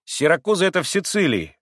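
put-on abrupt boy's interjection; loud, curt. A narrator's reading. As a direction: cut in sharply to explain. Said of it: "отрезал вдруг громко Коля".

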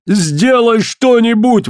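high, drawn-out desperate demand; acted desperate cry for action; exclaiming. A narrator's reading. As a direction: exclaim it with pitch thrown right up. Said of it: "воскликнул Коллинз".

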